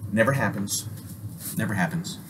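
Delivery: ordinary delivery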